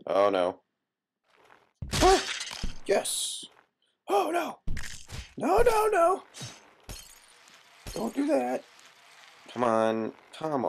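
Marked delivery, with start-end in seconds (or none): 2.0-8.6 panicked shriek. Real, not acted. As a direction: shriek in alarm as it goes wrong. Character slips in game.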